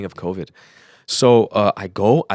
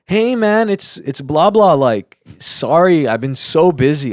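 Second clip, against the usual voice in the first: high, loud phone greeting; raised voice, stretched-out apology; friendly caller; 7 semitones higher, 8 dB louder, slower than usual. Retold or imitated. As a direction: imitate an effusive apologetic caller.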